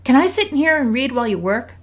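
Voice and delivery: put-on high, eager child's request; loud, high little-girl voice; young daughter. A narrator's reading. Voices daughter asking her mother.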